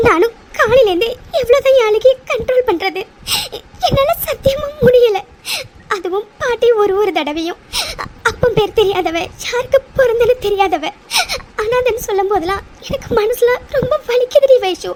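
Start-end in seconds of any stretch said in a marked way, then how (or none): 0.1-5.2 breaking into sobs, voice rising; crying alone at night after holding it in. Acted. 8.3-14.9 tearful, half-laughing sobs; crying. Acted. Repeating grandmother's insults, crying.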